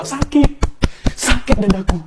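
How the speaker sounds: mock pain. Mock heartbreak, clutches chest.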